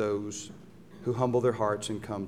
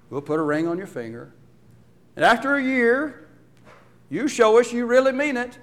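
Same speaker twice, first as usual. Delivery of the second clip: mock stern father; 6 semitones higher, 6 dB louder than usual. Imagined father setting probation for his son, mock stern and loud. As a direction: lay down conditions like a strict parent. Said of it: "the Father doesn't put him on probation".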